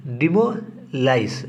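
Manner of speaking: डिमोलाइज drawn out syllable by syllable, very slowly; slow spelled-out word. Shown as a demonstration. Teacher repeats डिमोलाइज for the class, very slowly. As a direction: say डिमोलाइज slowly so students catch each sound. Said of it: "अगला word है बाबू डिमोलाइज".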